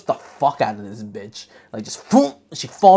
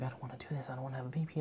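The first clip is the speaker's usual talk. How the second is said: mock scared murmur; 12 dB quieter, much faster than usual. Voicing the scared pregnant sister.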